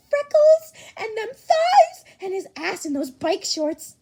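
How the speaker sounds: squealing through laughter; laughing gush. Acted. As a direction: gush while cracking up. Fangirl gushing in chat.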